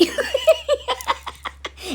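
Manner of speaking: very high-pitched long cackle; shrieky put-on laughter; mocking cackle. Mocking laugh inside God's warning.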